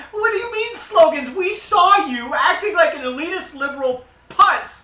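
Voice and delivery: high, loud mock indignation; indignant voter. Co-host as voter answering the politician, indignant. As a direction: fire back loudly, dragging out the insult.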